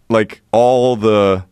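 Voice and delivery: ordinary delivery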